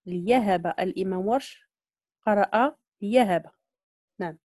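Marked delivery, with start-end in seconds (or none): 0.1-0.7 ليهب as "li-yahaba"; ya replacing hamza. Shown as a demonstration. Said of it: "بالياء مكان الهمز". 3.0-3.5 ليهب with a y-glide, "li-yahaba"; ya replacing hamza. Shown as a demonstration. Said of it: "بالياء مكان الهمز".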